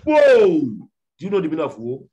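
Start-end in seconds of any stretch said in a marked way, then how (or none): 0.1-0.5 sudden loud high cry of woe; booming woe. Real, not acted. Preacher warning the congregation, loud cry. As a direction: thunder the word woe at the listeners.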